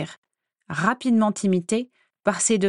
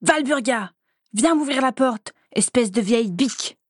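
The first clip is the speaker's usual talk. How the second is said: higher-pitched, demanding yell; calling through a door; 4 semitones higher than usual. A narrator's reading. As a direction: call out insultingly to be let in. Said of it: "retentit à travers la porte". (appel furieux à travers la porte)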